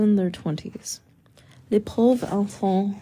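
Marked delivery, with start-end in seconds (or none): 1.7-3.0 slow murmured French, full of pity. A narrator's reading. Villagers pitying the children, slow French murmur. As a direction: sigh it in French, slowly, with pity. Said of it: "the inhabitants mur-, murmur".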